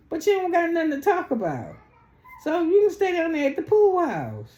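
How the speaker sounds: high, loud mocking jeer; mock taunting. Mocking the cast member who has to stay at the pool house, high and loud.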